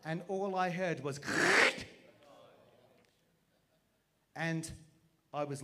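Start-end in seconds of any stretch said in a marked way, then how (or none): 1.2-1.9 high, loud mocking cackle; mocking laugh. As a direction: laugh at the fallen skater. Onlookers laughing at the fall.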